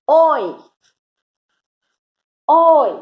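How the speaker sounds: ओय called out loud and high; oy sound. Shown as a demonstration. Teacher demonstrating the oy sound loudly to her child. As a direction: call ओय like hailing a boat. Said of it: "the fourth sound is".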